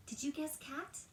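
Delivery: plain reading voice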